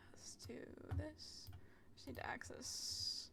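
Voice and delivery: soft secretive whisper; hushed whispering to herself. Real, not acted. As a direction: breathe it like a secret. Sneaking into the menu.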